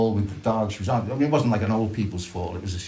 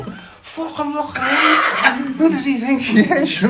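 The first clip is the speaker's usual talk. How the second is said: much higher, louder, slow jeering voice with a laugh in it; mocking Liverpool passer-by; 7 dB louder, slower than usual. A stranger in the street jeering at loud new trousers, mocking. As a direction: sneer at the lad in new trousers like a scornful stranger. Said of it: "hear someone in the street go".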